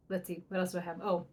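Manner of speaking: ordinary talk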